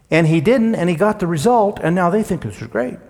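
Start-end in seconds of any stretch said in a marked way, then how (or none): none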